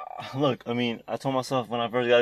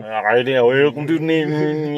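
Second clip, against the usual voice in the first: backwards speech; 3 semitones higher, 7 dB louder than usual. Retold or imitated. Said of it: "He's talking backwards".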